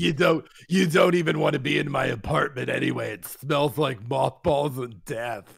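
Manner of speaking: put-on crotchety voice, slowing at the end; hammed-up old-lady impression; cranky old lady. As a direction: play a grumbling old woman, dragging the last words.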